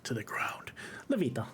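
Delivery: soft breathy whisper. Real, not acted. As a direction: whisper it under your breath. Whispered aside after laugh.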